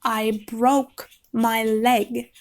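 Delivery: drawn out very slowly, each word weighted; slow insistent repeat. Retold or imitated. Girl repeats her injury to Tristan, slow and insistent. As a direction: repeat it slowly so he finally hears. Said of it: "And she says".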